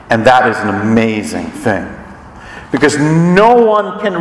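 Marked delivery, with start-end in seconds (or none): none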